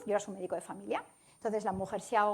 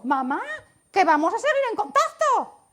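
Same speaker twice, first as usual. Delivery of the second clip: laughing; 10 semitones higher, 10 dB louder than usual. Retold or imitated. Children talking to dying mother.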